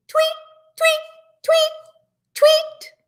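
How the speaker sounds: high, piping little chirp; squeaky bird-call imitation; bird tweet. A narrator's reading. Imitates birds in the tree.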